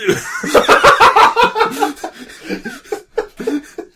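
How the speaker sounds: cracking up at the bad fanfic; high squealing laugh. Real, not acted. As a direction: burst into helpless high laughter. Collapses laughing after reading.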